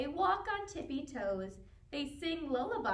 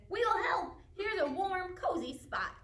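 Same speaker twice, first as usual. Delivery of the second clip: lightly higher, eager hare voice; helpful hare; 8 semitones higher than usual. A narrator's reading. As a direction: offer help brightly as Hare. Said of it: "Hare says".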